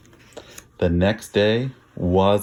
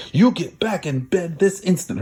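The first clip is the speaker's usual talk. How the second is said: mother's order; 8 semitones higher than usual. Acted. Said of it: "her mother ordered".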